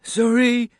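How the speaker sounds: put-on high, loud, upset child voice; tearful apology. A narrator's reading. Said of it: "He got his crying under control".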